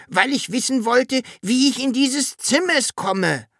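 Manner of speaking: high-pitched small dragon explaining; little dragon answering. A narrator's reading. Dragon explaining how it was misunderstood, high insistent voice. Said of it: "antwortete der Drache".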